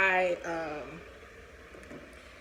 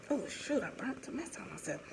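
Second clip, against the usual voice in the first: real muttered aside; 2 semitones higher, 10 dB quieter than usual. Real, not acted. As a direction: let a small mishap slip out under your breath. Interrupts story over own mishap.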